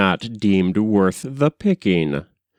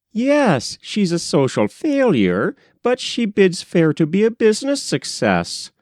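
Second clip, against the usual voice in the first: higher, dry jab at a social failure; a rejected suitor taking a dig; wry young man; 10 semitones higher than usual. A narrator's reading. Young man agrees with the manager, dry and higher.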